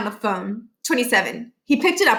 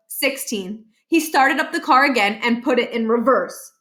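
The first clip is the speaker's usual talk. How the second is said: plain reading voice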